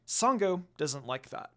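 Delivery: usual speaking voice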